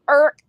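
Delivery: "Irk" for Eric, one loud high syllable; Saint Louis pronunciation. Shown as a demonstration. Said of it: "That's what we say in Saint Louis".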